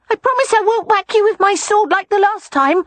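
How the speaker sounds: put-on high child voice, promising; small boy wheedling, high and loud; coaxing little boy. A narrator's reading. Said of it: "he coaxed".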